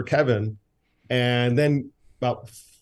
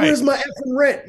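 put-on high, louder squeaky kid yelling; gruff impatient scolding; 5 dB louder than usual. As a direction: scold harder, like a furious little landlord. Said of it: "And she's like".

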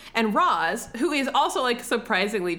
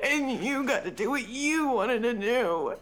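hammed-up wailing complaint; mock tearful betrayal; 6 semitones higher than usual. Wronged partner comparing who got their way, mock crying. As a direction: wail it like a weeping, wronged partner. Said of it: "I was Robin Wright sitting there".

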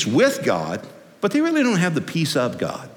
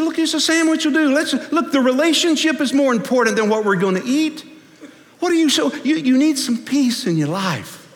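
high, comically pleading voice; high, playful, exasperated coaxing; imagined Jesus inflection; 10 semitones higher than usual. Acted. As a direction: reason with her in a high, put-on voice. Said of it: "I think Jesus looked at her and said".

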